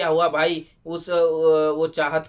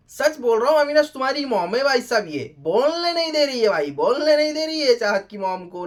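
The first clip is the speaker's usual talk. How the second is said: exasperated exclaiming; 5 semitones higher than usual. Real, not acted. Reviewer tells Avinash about his overbearing mom, exasperated. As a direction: exclaim it in disbelief, pitched up high. Exclaims over mother not letting speak.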